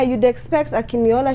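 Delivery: normal talking voice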